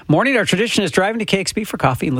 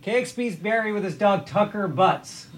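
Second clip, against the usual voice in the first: softer repeat; 4 semitones higher, 6 dB quieter than usual. Real, not acted. Repeats the shout-out, amused, off level.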